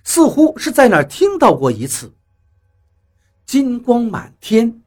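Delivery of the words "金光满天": slow, drawn-out murmuring to oneself. A narrator's reading. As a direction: repeat it slowly, puzzling over each word. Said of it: "不断地念叨着这几个字". (慢慢地反复念叨)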